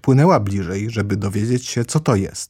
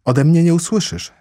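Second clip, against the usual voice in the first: higher, eager reassurance; hasty assurance; 8 semitones higher, 4 dB louder than usual. A narrator's reading. Alice hurries to change the subject, eagerly reassuring. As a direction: promise it at once, flustered. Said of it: "chcąc pospiesznie zmienić temat rozmowy".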